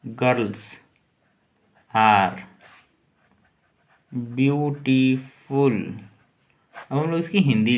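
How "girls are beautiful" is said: each word said apart with long pauses. Shown as a demonstration. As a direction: space the words out for learners. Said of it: "इसको बोलेंगे these". (शब्द-शब्द रुककर)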